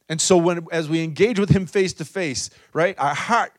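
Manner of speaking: loud ringing preacher's exhortation; loud altar-call preaching; full-force preaching. Acted. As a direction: lift the room with it, loud. Preaching climax to congregation.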